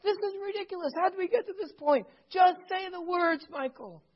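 high, pleading wife voice; lightly voiced exasperated wife. Wife pleading with husband in the kitchen, high and exasperated. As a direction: beg him to just say it. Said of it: "She looks back at him, saying".

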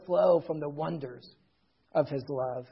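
low, soft, reverent close; hushed solemn preaching. Real, not acted. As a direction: drop to a quiet, reflective murmur, pausing between phrases.

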